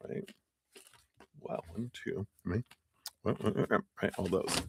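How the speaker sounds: talking around a throat lozenge; crunching a lozenge. Real, not acted. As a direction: mouth full of cough drop. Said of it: "as I'm crunching my throat lozenge here".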